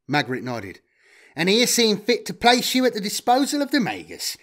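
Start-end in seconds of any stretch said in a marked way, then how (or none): 1.3-4.4 lightly voiced smug trader. A narrator's reading. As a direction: say it with a smug smile. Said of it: "he smiled".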